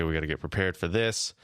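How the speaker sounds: usual speaking voice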